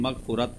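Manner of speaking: मग़फ़ुरत with "u" after f: magh-fu-rat; mispronunciation. Shown as a demonstration. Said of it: "गलत पढ़ देते हैं". (मग़फ़ुरत को ग़लती से "मग़-फ़ु-रत" बोलते हुए)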